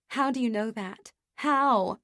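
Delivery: a despairing cry for reassurance; tearful wail. A narrator's reading. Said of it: "I wail".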